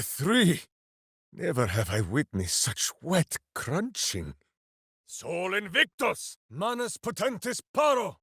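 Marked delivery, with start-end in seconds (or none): 5.1-8.2 high, booming spell chant; shouted incantation. Acted. Warrior casting a spell mid-fight, shouting the incantation. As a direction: hurl the Latin words like a battle cry.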